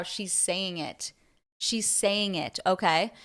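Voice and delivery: ordinary talk, nothing marked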